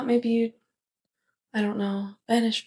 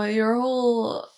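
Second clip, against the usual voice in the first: ordinary delivery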